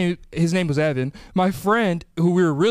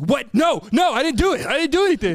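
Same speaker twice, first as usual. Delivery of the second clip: put-on high, frantic kid denying it; panicked denial; 7 semitones higher than usual. Accused kid denying to snitching classmates, high and frantic. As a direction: plead innocence in a rush, pitched up. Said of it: "I would be like".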